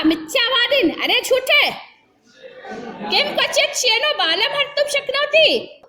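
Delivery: 1 accusing merchant. Acted. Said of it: "श्रेष्ठी अवदत्".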